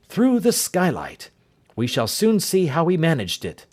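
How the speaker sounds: a clipped, commanding Holmes taking charge; urgent detective giving orders; Holmes, brisk and urgent. Acted. Holmes examines the empty room.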